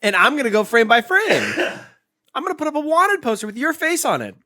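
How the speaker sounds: high, loud and laughing. Real, not acted. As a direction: tease him through laughter. Teasing, laughing at friend.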